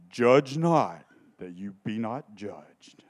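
low, slow whispered quote. Pretend. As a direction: breathe the objectors' verse, low and slow. Quoting the objectors' line.

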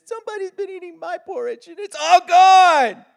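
high little cub, upset his bowl is empty; baby bear. Acted. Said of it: "the little baby bear said".

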